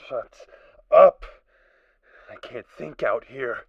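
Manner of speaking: loud, high, raw shouting; furious scream. Acted. Tormented survivor screams at the voices outside, enraged.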